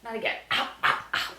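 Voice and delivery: ordinary talk, nothing marked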